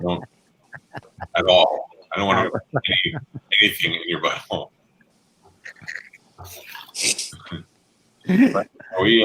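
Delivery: thin voice no bass; tinny voice. Real, not acted. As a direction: laptop mic sound. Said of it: "This guy said I sound tinny".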